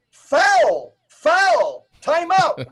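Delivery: imitating opponents crying foul; mock protest calls. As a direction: call it out like an outraged referee. Mimics opponents calling out a foul.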